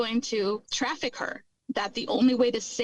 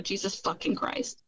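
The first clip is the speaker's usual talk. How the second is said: ordinary talk, nothing marked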